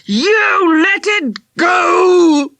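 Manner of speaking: slow, rising anguished shout; voice out of control. Acted. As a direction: let the yell crack and run away. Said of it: "His voice rose, out of control".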